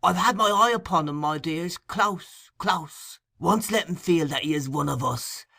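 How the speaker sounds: high, wheedling villain's voice. A narrator's reading. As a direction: savour every word of the scheme.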